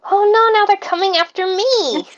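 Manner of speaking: hammed-up high yelp of alarm; yelping in panic. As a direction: cry out high like a frightened character under attack. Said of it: "yelps and is like".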